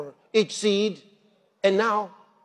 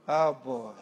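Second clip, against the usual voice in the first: ordinary delivery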